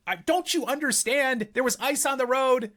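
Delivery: high, exasperated protest; replaying his angry protest to dad; retold outrage. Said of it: "I was so mad. I'm like".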